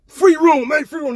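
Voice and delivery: high, loud insistence, easing at the end; insistent shout. Retold or imitated. Narrator shouting to his confused friends about the free room. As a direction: push the words at them. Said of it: "I'm like".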